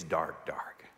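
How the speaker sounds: fading hushed whisper; preacher evoking pitch darkness on a camping trip. Real, not acted. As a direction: let dark trail off into nothing. Unvoiced fading.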